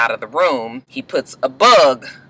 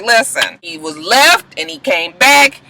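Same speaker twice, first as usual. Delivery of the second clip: lightly voiced mother, higher; a mother brushing off her daughter's complaint; higher mom defending the daddy coming back; mother's voice; 8 semitones higher, 4 dB louder than usual.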